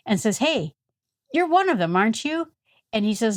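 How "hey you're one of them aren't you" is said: put-on high-pitched little girl accusing someone; voiced little girl. Girl to Peter by the fire, high accusing voice. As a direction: pipe up as a girl spotting a suspect. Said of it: "a little girl sees him".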